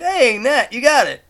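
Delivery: high, loud playful cheer; teasing, high-pitched praise; mock congratulation. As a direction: cheer her on as if she finally caught up. Acts out cheering on Natalie.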